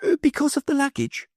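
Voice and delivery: meek. A narrator's reading. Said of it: "meekly replied".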